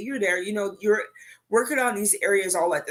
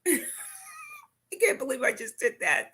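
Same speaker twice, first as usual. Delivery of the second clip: high, slow helpless laugh; cracking up at herself; 11 semitones higher, 3 dB quieter, slower than usual. Real, not acted. Laughing at own joke.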